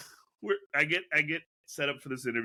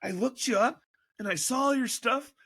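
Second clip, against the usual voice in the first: gushing mock fan; 10 semitones higher than usual. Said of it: "they were like".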